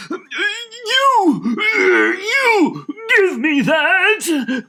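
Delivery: high, loud, drawn-out angry yell; furious shout. Acted. Dussel grabbing the thief, furious yell. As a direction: seize him and demand the bread.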